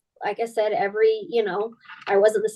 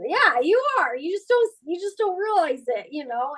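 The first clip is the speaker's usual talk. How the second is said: much higher, lightly voiced coach; coach's reassurance; 7 semitones higher than usual. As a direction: reassure her in a raised voice. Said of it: "I'm like".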